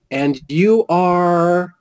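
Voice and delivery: high, very slow imitated question; lady at the table. Lady asking the stranger his name, high and drawn out. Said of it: "She goes".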